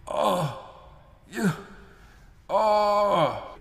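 high mock groans of pain. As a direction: groan like a wounded man. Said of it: "you hear".

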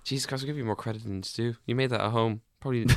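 normal talking voice

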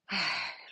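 a long, soft breath out; releasing sigh. Shown as a demonstration. Showing how to release an emotion, soft sigh. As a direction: let the air go in a slow, quiet sigh.

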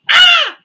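piercing, high startled scream; full-out scream. Retold or imitated. Recalling a cockroach sneak attack, full-out scream. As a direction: scream out loud at the bug. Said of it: "one hundred percent screamed out".

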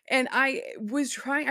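slow words through suppressed laughter; holding back a laugh. Real, not acted. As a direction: fight off the giggles as you speak. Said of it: "I was really trying not to laugh".

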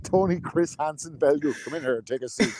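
a hint of a stern TV sting host, slowed down; mock TV host calmly inviting the suspect in; Chris Hansen. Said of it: "Aim said".

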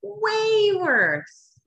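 "Way" very high and drawn out, falling on "worse"; emphatic exclamation. Real, not acted. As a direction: repeat it with a soaring "Way". Repeats the emphatic answer.

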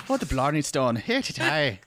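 loud, hammed-up Irish caricature; mock Irish accent. Co-host joking about Ireland, mock Irish accent. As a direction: play up a silly Irish accent.

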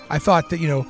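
ordinary talk

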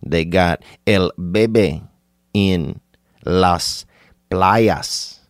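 put-on slow, deliberate Spanish-accented words; mock Spanish accent. Stranger nudging you about a baby, slow mock Spanish accent. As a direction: stretch each word in a put-on Spanish accent.

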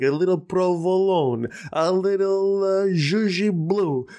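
hammed-up New York accent, high and slow. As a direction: savor the deli names in a New York accent. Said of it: "New York accent".

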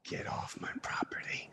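whispered threat, very soft; soft dangerous whisper; menacing whisper. Retold or imitated. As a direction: hiss the warning under your breath. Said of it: "And he goes".

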